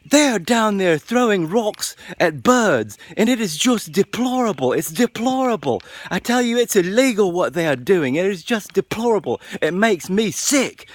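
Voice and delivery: put-on British accent. British lady scolds parents over kids throwing rocks, high mock outrage. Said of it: "she just tears into us".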